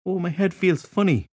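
high, lilting "Oh, my head feels funny"; slightly sing-song. A narrator's reading. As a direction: let "funny" lilt in a light sing-song. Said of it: "in a slightly sing-song voice".

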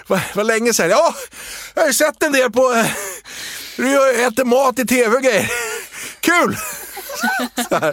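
very high, eager squeaky voice; old classmate's voice. Retold or imitated. Classmate in the tube costume chatting to Erik, very high and eager. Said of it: "treåröret bara".

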